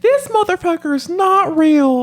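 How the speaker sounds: very high, slow squeak; high squeaky voice. Acted. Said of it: "That sounds like you".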